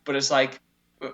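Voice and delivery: ordinary delivery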